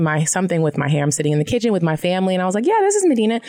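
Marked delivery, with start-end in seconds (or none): 2.7-3.4 chirpy phone answer. Retold or imitated.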